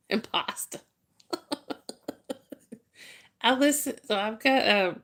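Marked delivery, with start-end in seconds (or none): 0.1-0.8 put-on Jersey Italian accent, impasta with a soft whispery s; Italian-American impasta. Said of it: "how he would say imposter would be impasta".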